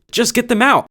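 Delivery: high, loud demanding voice; shrill insistence. Acted. Demanding governor act.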